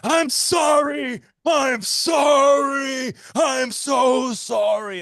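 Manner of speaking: high, drawn-out wailing apology; Marty Funkhauser's profound apology. Retold or imitated. Acting out Marty begging Larry's forgiveness, high mock wail. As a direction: wail it out, hugely hammed-up and slow. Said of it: "the profound apology".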